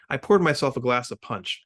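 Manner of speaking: plain reading voice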